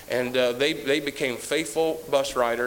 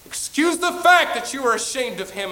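rising to a loud, high cry of shame; the preacher's swelling self-rebuke; climactic rebuke; 9 semitones higher, 6 dB louder than usual. Retold or imitated. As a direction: build to a cry on the last words.

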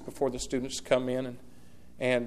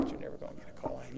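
quiet fast aside; 12 dB quieter, much faster than usual. Real, not acted. Repeated for emphasis.

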